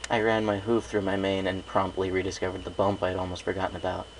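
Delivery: ordinary delivery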